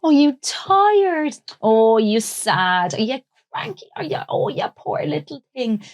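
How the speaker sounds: put-on high, slow, sing-song soothing to a toddler; cooing baby talk. Parent comforting a hurt little kid, high slow cooing baby talk. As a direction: coo it like you're picking up a hurt child. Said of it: "you pick them up and you go".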